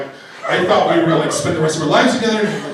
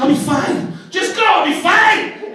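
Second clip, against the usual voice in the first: high-pitched retold yell; yelling argument; 11 semitones higher, slower than usual. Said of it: "we're having this yelling, yelling argument".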